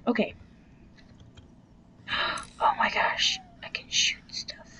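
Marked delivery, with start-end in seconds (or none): 2.1-4.7 gasping whispered excitement; excited whisper. Real, not acted. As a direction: gasp then hushed thrilled whisper.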